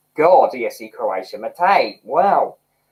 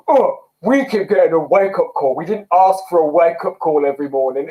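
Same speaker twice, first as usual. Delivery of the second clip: miserable mum. Retold or imitated. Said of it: "who's really miserable".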